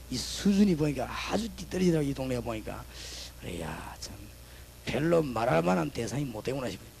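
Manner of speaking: ordinary talk, nothing marked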